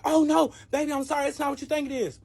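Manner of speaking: a hammed-up guilty boyfriend begging; pleading boyfriend. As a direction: caught man whining sorry. Boyfriend caught cheating.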